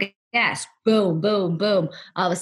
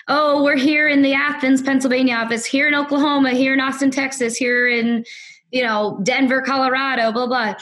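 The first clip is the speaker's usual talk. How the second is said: conference-call roll call; 5 semitones higher than usual. Retold or imitated. Office staff checking in on the company conference call, mock corporate roll call. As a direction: announce each office in a raised, even voice.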